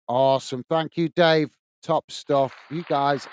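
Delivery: ordinary delivery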